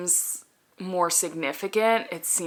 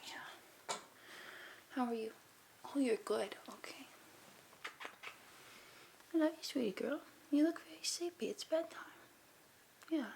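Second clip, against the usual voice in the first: soothing a dog; 3 semitones higher, 11 dB quieter than usual. Real, not acted. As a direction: coo gently to a sleepy dog. Talking softly to the dog.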